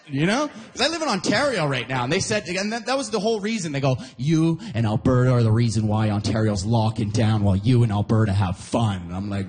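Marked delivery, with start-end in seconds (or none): 4.2-9.0 lower-pitched, lightly acted blame; mock accusing. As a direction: imitate friends pinning the blame on you. Friends blaming him, acted quote.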